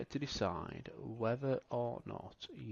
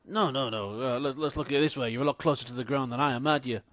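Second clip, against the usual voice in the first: mocking taunt; 2 semitones higher, 6 dB louder, much faster than usual. [name] taunts Miles.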